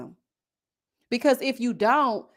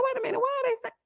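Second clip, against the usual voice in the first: baffled; 13 semitones higher, 5 dB quieter, much faster than usual. Retold or imitated. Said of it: "I said".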